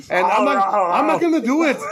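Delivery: high, hammed-up refusal; Ben Richards. Host retells the pilot defying home base, hammed-up hero voice. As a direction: refuse orders in a mock action-hero voice. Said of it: "Ben Richards is like".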